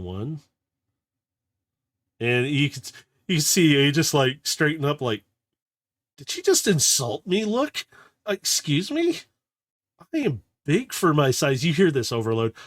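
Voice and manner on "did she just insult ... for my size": high, indignant voice; a small bot bristling at being called little; offended little robot. Acted. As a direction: sputter it out high, stung by the insult. Aegis voiced, offended by insult.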